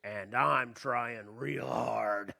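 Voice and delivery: calm restraint. Retold or imitated. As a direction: say it slowly, holding your temper. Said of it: "She said".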